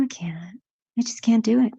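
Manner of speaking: ordinary talk, nothing marked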